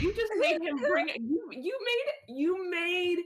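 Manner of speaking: high, stammering indignation; outraged protest. Real, not acted. Player objects to the game master's move, indignant.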